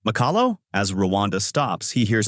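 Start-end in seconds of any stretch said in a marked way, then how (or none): 0.1-0.6 higher, questioning name; surprised recognition. A narrator's reading. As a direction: recognize someone with mild surprise. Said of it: "Ivan recognizes the lady and says".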